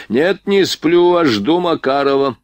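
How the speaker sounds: high, drawn-out retort; slow, high princely voice, insistent; imperious prince, contradicting. Acted. Drunk prince contradicts the whisperer.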